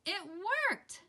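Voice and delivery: bright jump up for the win; joyful little cheer; excited. A narrator's reading. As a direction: celebrate the breakthrough. Triumphant moment in story.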